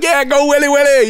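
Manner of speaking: high mock crowd cheer; imitated kids' rallying call; encouraging cheer. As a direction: egg him on from below. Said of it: "We're encouraging him".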